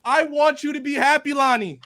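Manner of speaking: solemn preacher addressing the congregation; hammed-up funeral sermon voice; eulogy for a fan let down by Tokyo Ghoul; mock preacher eulogy.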